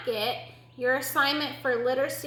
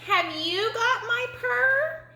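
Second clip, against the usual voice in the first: very high, slow kitten voice; high drawn-out question, rising at the end; 11 semitones higher, slower than usual. Acted. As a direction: ask hopefully as a little kitten.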